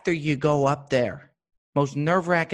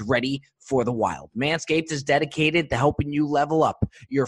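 ad read. Acted. Sponsor ad read.

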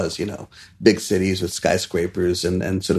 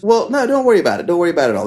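reassuring; 7 semitones higher, much faster than usual. Retold or imitated. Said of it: "And Josh goes".

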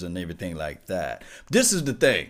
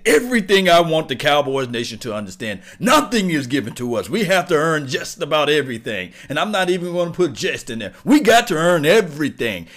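fired-up shout; 6 semitones higher, 8 dB louder than usual. Real, not acted. Fired-up rant to fans.

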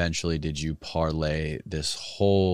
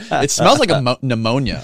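laughing; 7 semitones higher, 12 dB louder than usual. Real, not acted. Laughing over the salts.

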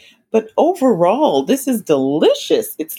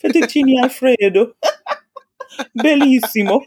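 mock Italian; 6 semitones higher than usual. Mock Italian after movie mention.

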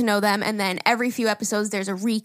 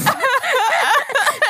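high squealing laugh mixed with crying; shrieking laugh-cry; 7 dB louder than usual. Retold or imitated. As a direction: let the laugh break into a cry. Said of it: "hysterical crying so hard".

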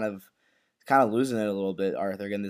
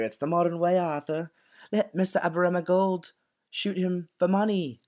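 higher-pitched put-on character voice; Linda; 7 semitones higher than usual. Host mimics Linda talking to Arthur, high put-on voice. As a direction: imitate a wife urging her husband on, pitched up. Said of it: "And Linda was talking about earlier".

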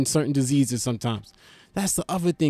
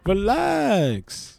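Relax drawn out higher with a falling glide; sing-song relax; 5 semitones higher than usual. Shown as a demonstration. Telling a hypothetical heckler to relax, drawn-out higher. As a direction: lift Relax up then let it fall. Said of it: "What you talking about?".

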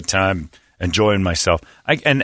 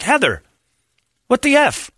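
shocked outburst; 13 semitones higher than usual. Retold or imitated. Shocked at teeth in car.

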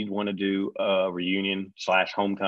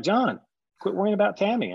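high, louder brushing him off; producer voice; 11 semitones higher, 5 dB louder than usual. Retold or imitated. Producer brushing off John's worry, high and airy. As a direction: wave his worry away.

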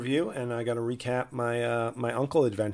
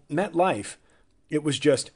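normal talking voice

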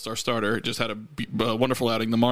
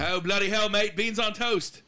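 high, hammed-up cockney British; mock British accent; 7 semitones higher, slower than usual. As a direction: overdo a London accent. Mock British accent after East London.

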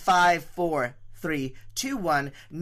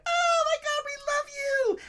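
very high-pitched squeal; fans' mock scream. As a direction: imitate a gaggle of screaming fans. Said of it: "a bunch of queens screamed".

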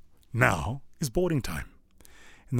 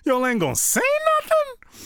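high, loud outraged disbelief; 4 dB louder than usual. Retold or imitated. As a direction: cry out in shock at them. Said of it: "I was like".

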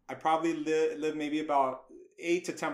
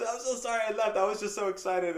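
higher, pleading apology; earnest apology; 5 semitones higher than usual. Retold or imitated. New hire explaining himself to line producer, pleadingly apologetic. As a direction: beg pardon for getting carried away.

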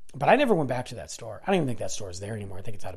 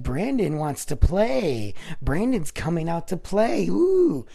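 drawn-out singsong taunt, ending on a high ooh; stupid clown voice; 5 semitones higher, 4 dB louder, slower than usual. Retold or imitated. Clown teasing Brandon to come out and play, stupid voice. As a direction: mimic a clown's silly taunting voice. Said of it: "he's making this stupid voice".

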